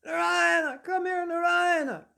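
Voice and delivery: high, loud, frantic call to a son; acted panicked scream; screaming for help. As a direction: scream the name as if terrified. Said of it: "As he's screaming".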